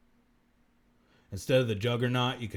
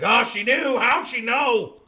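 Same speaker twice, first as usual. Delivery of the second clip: loud, high-pitched mock panic; mock alarmed outburst; 14 semitones higher, 13 dB louder, slower than usual. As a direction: cry out slowly in hammed-up alarm. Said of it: "I'm gonna be like".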